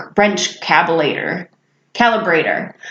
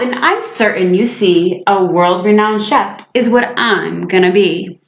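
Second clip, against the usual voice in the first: ordinary delivery, nothing marked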